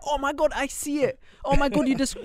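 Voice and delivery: high-pitched gushing mock reaction; excited commenters.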